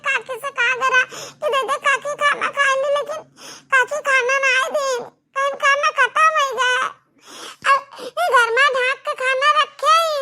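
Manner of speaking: put-on a hungry little girl whining to her mother; child's voice. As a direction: kid begging mummy. Child character complaining.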